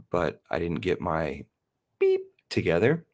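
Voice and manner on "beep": self-censor with a beep; bleep censor sound. Acted. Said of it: "android there".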